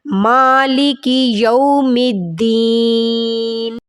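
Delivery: slow, drawn-out tajweed chant; measured Quranic recitation. Shown as a demonstration. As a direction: recite it melodically, stretching the vowels.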